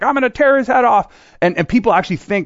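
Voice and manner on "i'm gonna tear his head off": high, loud hammed-up bad cop; mock tough cop threat, much higher and louder. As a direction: snarl it like a raging movie cop. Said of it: "the bad cop's like".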